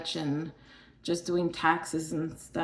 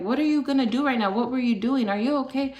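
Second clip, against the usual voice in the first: quick, high-pitched imitation of worried staff; retold rapid questioning; 6 semitones higher, faster than usual. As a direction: rattle off questions as if mimicking alarmed nurses. Staff firing anxious questions at her.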